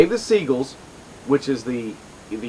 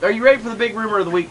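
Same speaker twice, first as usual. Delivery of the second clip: hyped announcer tease; 8 semitones higher, much faster than usual. Shown as a demonstration. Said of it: "shut up".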